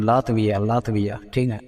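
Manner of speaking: ordinary talk, nothing marked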